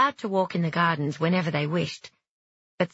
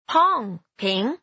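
put-on squeaky baby dragon; 5 semitones higher, 4 dB louder than usual.

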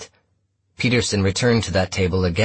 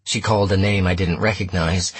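ordinary delivery

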